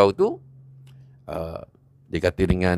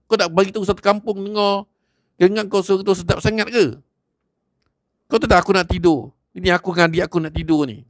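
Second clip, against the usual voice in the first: furious threats; 8 semitones higher than usual. Acted. A young man threatens Haji Hamid, high and furious. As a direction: threaten him while gripping his collar.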